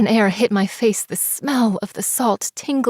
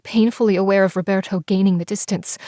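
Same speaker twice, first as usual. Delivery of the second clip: ordinary delivery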